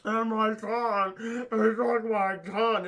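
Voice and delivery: slow, drawn-out put-on moan; mock tongueless wail. Mutilated man cries about his tongue, mock wailing. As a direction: imitate a victim wailing slowly without a tongue.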